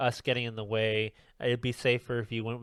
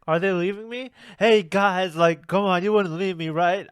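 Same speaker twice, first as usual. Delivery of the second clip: anxious pleading; 7 semitones higher, 5 dB louder than usual. Retold or imitated. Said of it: "Usopp's like".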